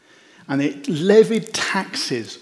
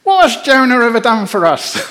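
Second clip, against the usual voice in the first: mock indignant crowd, louder; hammed-up grumbling rebel; Monty Python quote; 5 semitones higher, 6 dB louder than usual. Sailors complaining about Jonah, mock indignant. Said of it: "reference to, um, to Monty Python".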